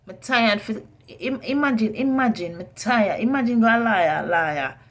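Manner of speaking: loud, heated outburst; furious chef. Real, not acted. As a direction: shout it out in anger. Venting angrily about being exploited.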